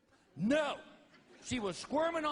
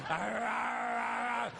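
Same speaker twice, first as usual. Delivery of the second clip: dog growling; 4 semitones higher, much slower than usual. Retold or imitated. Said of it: "He's like".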